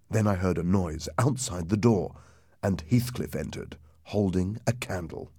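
usual speaking voice

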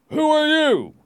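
high, loud demand; loud, high-pitched challenge; challenging stranger. A narrator's reading. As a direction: call out sharply to halt strangers. Stranger challenges travelers on the road.

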